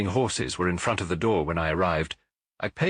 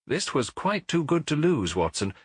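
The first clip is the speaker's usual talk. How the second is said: ordinary delivery, nothing marked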